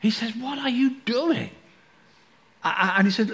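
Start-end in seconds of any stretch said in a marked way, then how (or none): 0.4-1.5 outraged Paul. Retold or imitated. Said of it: "He's outraged".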